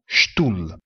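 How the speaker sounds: Stuhl lento, ST detto SCHT; pronuncia tedesca. Shown as a demonstration. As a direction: Stuhl scandito come SCHTUL. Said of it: "si pronuncia St". (Stuhl con la S iniziale come SCI)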